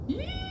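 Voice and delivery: a sudden high squeal of delight; excited squeal. Real, not acted. Host squealing on her live chat, excited squeal.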